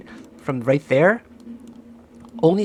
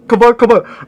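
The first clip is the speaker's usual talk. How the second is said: ordinary delivery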